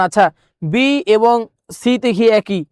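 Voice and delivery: ordinary talk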